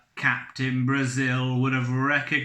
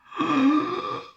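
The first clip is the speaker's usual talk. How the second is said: high startled gasp; 14 semitones higher, 4 dB louder than usual. Acted. Captain sees feminine figure.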